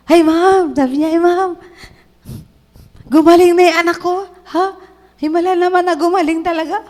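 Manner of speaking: overjoyed mother. Retold or imitated. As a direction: gush with relief that the child is healed. Tuwan-tuwa siya.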